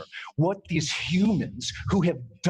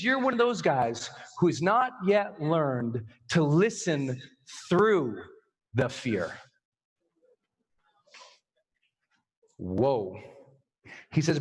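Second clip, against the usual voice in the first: solemn mentor; 2 semitones lower, slower than usual. Retold or imitated. Instructor's solemn lesson, retold.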